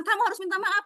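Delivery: high, insistent command; forcing apology. Retold or imitated. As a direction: force the child to apologise. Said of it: "jangan dipaksa". (memaksa anak minta maaf dengan nada tinggi)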